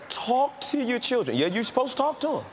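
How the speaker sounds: high mocking sing-song; mocking advice. Preacher mocking society's advice to parents. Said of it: "the fantasy is".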